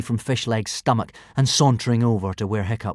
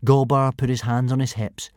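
ordinary delivery

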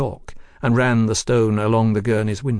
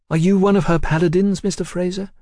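higher, lightly voiced woman; a lady probing with a lilt; woman's voice; 8 semitones higher, 4 dB louder than usual. Woman quizzing Fraser, lightly voiced higher.

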